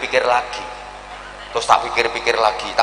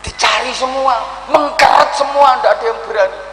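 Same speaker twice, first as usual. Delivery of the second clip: rising shout; 10 semitones higher than usual. Acted. Preacher crying out that all cower.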